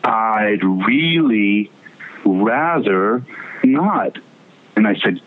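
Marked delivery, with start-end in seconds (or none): 0.0-4.2 each word slowly spaced with pauses; mock solemn drawn-out no; slow deliberate refusal. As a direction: drag it out, heavy pauses between words. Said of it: "he turns to me and he goes".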